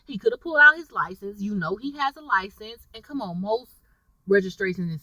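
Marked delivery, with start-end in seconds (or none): none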